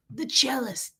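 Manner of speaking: hushed, whispered mock title. Mocking husband's nickname for himself, in a whisper.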